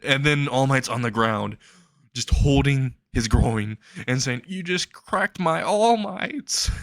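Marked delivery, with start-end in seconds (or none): 4.5-6.7 high, slow strained whine; drawn-out mock agony; pained. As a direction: squeal it like a groin hit. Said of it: "he's in pain".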